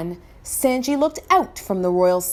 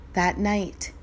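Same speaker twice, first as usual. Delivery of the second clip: usual speaking voice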